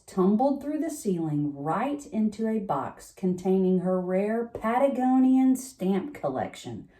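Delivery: ordinary delivery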